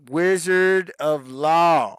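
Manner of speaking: hammed-up announcer voice; booming name call. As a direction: stretch the name out like a ring announcer. Announcing a fan's username.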